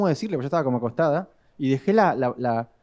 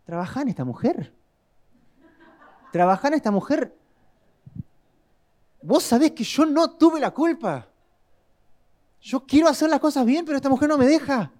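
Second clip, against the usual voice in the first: whiny prayer; 7 semitones higher than usual. Retold or imitated. Husband praying in the living room after a fight, in a whiny high complaint. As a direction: complain to God like a wronged husband. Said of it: "Y digo".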